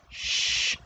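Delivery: hushed "shh"; shush. Acted. Elder hushing a cursing younger friend, soft shush. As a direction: shush them softly.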